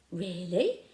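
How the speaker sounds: overly surprised question; too surprised. A narrator's reading. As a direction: blurt out your surprise. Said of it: "sounded a little too surprised".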